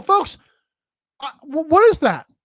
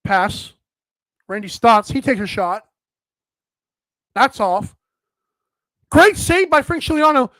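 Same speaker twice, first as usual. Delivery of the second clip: subdued broadcast call; 4 semitones lower than usual. Retold or imitated. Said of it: "This is exactly how it sounded on the broadcast".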